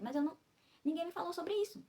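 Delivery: high-pitched, aggrieved complaint; indignant protest. Real, not acted. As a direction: protest that you were never told. Complains nobody warned her.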